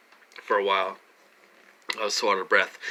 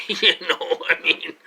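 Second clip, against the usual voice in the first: higher, louder, through a laugh; 8 semitones higher, 6 dB louder than usual. Real, not acted. Tickled by an awkward remark to viewers, laughing. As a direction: let a laugh break into the words.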